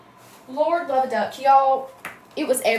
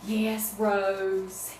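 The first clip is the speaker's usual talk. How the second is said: ordinary talk, nothing marked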